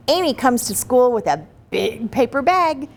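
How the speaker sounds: ordinary delivery, nothing marked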